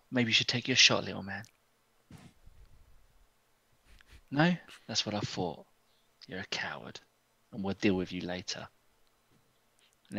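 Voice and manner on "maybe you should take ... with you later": very quiet, low-pitched menace; hushed taunt. Acted. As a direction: goad him almost under your breath. Ilmarin taunts guard in close exchange.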